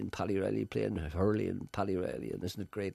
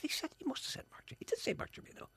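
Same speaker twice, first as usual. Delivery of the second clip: high, fast, breathless whispering; excited whisper; faster than usual. Retold or imitated. Telling himself about his idol on TV, a high excited whisper. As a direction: whisper it fast, barely containing the thrill. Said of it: "I said".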